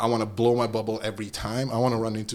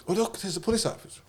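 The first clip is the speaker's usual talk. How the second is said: mock sweet tattling; 7 semitones higher, 6 dB quieter than usual. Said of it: "She's like".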